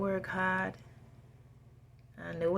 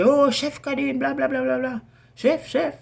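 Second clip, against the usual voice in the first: high, loud fawning praise; gushing coworkers; 7 semitones higher, 7 dB louder than usual. Retold or imitated. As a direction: imitate people flattering the chef. Mimicking coworkers hyping him up.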